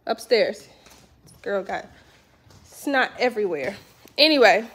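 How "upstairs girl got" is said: ordinary talk, nothing marked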